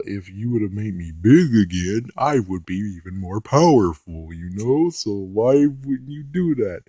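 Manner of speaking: whiny villain monster demanding why. Retold or imitated. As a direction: growl it out like the sulking monster. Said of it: "the monster's like".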